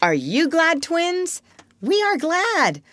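put-on very high childish voices; eager children. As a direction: chime in like small twins. Child and twins in eager exchange.

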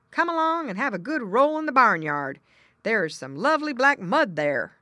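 high, loud hearty pig voice; jolly pig. Acted. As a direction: boom it out like a jovial hog. Pig brother invites child.

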